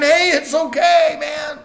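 high, slow coaxing call, half shouted; Satan's coaxing. Acted. Satan coaxing her that it's okay, high and slow with a mock shout. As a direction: tempt them, slow and easy, up high. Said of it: "When Satan was sitting there speaking".

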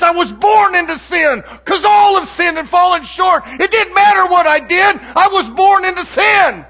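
high, loud, shouting sermon delivery; preaching at full force. Acted. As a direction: preach it out to the whole congregation. Preacher builds to full fire.

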